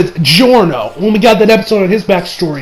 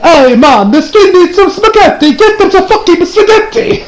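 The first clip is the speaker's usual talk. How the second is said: high-pitched, hammed-up Italian accent; comic Italian accent, pitched way up; 11 semitones higher, 4 dB louder than usual. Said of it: "let me do this in the Italian accent".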